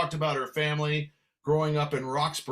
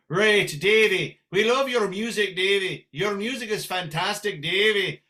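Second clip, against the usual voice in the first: higher, lilting Irish brogue; 4 semitones higher than usual. Shown as a demonstration. Irish fans praising [name]'s music, higher Irish brogue. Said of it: "When I hear [name] in an Irish brogue".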